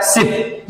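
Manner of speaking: सिप with a quick short i; soft, instant सिप, no lingering on the vowel. Shown as a demonstration. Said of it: "ये short sound है".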